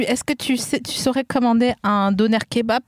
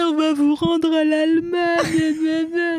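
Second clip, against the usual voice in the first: slow put-on German voice; mock German threat; 4 semitones higher, much slower than usual. Joking that Alsace goes back to Germany, slow mock German. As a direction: tease slowly in a mock German voice. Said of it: "en train de nous dire".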